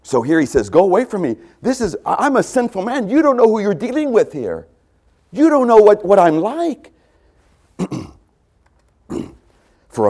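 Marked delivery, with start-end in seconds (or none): 0.7-6.9 higher, louder, urgent plea; pleading Peter. Acted. Peter at Jesus' feet, urgent and pleading. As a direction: cry out your unworthiness.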